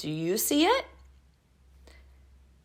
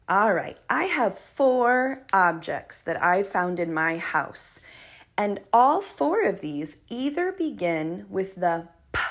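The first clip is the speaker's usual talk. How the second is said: normal talking voice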